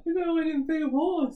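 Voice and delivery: high laughing protest. Real, not acted. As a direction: laugh through the words. Friend picked horse.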